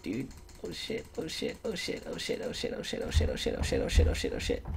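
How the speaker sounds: frantic whispered repeated curse; panicked whisper. Real, not acted. Panicking at low health.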